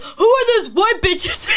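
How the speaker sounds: put-on high, loud, drawn-out drunken holler; drunk friends. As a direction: imitate drunk friends yelling across the room. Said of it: "my drunk friends were like".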